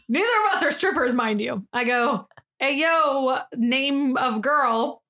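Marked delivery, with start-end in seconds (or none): 2.6-5.0 slow drawn-out. Retold or imitated. Finding a stripper pole at home.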